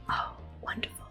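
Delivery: normal talking voice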